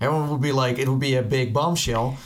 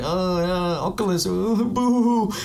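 high, mock-wailing boomer moan; whining boomer; 6 semitones higher, much slower than usual. Imagining future boomers mourning Oculus, high mock wail. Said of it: "we're gonna be these boomers who are like".

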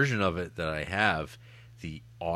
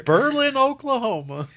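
put-on very high, loud comic German accent; absurd German accent; 9 dB louder than usual. Co-host parodying the Nazi-like Cenobite, absurd German accent. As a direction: shriek it in a botched German accent. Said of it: "My favorite German accent".

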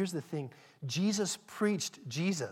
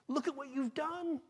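much higher, ecstatic worship cry; rapturous praise; 10 semitones higher than usual. Acted. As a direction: lift it up in awe. Voicing praise before God's throne.

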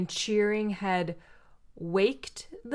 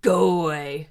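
low, louder curt brush-off; grumpy pig; 5 semitones lower, 5 dB louder than usual. Retold or imitated. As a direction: shoo him off like a grumpy pig. Pig dismisses groundhog.

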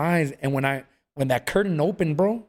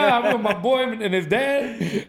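laughing; 5 semitones higher, much slower than usual. Real, not acted. Laughing while retelling the story.

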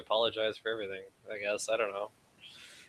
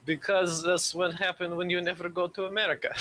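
put-on jokey Russian accent, a bit higher; 7 semitones higher than usual. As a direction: keep the mock Russian accent going. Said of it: "Why are you doing, like, a Russian accent?".

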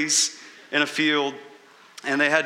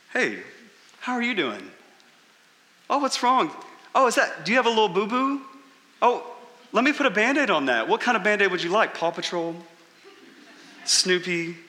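mock sugary baby talk to a patient, pitched up; cooing surgeon; 5 semitones higher than usual. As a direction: fuss over a tiny scrape like a doting nurse. Surgeon coos at another patient.

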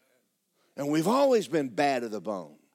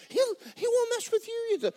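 high mock Satan voice. Preacher mocks Satan.

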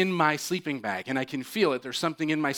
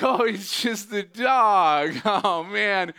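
embarrassed relief; 6 semitones higher, 6 dB louder, much slower than usual. Retold or imitated. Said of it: "and so I'm like".

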